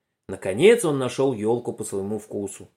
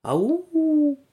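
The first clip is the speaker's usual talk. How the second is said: echo. Acted. Echo repeats the boys' call, high, drawn-out and distant. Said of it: "повторило эхо".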